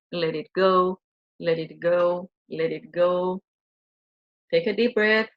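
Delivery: gentle guided-meditation chant; soothing guided release. Acted.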